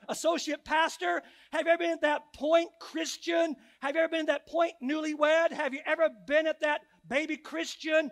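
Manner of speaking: fervent altar-call preaching; preaching at full force. Acted. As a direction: preach it out to the whole room, rising each time. Calls out to each group.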